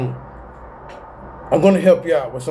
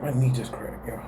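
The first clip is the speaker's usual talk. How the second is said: whispered aside about his knee; softly whispered aside; 4 semitones lower, 8 dB quieter than usual. Real, not acted. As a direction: hush it as the knee cracks. Aside while knee cracks.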